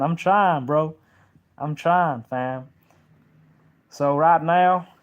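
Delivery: loud, high put-on Texas accent. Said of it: "my Texas accent".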